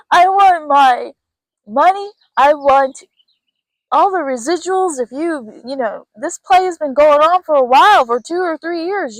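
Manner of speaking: high, loud, hammed-up shout for money; mock demanding shout. Client turning on the director, loud mock shout for money. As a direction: belt it out like a fed-up actor demanding pay. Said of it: "you're like".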